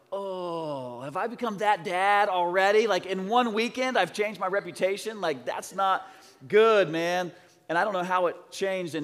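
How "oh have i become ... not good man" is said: higher and louder, hammed-up alarm; mock dismay. Dad reacting to son's nap remark, mock dismayed. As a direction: play the stung dad, half joking. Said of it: "I was like".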